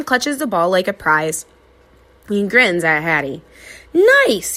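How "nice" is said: high, loud cheer; dad cheering his daughter's throw; happy shout. A narrator's reading. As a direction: praise her with a shout. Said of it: "he shouts happily".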